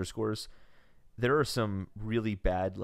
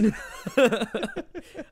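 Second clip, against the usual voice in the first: high giggle; 12 semitones higher, 4 dB louder than usual. Real, not acted. Laughing at the joke.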